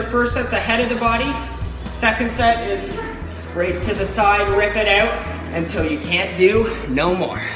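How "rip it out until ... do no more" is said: usual speaking voice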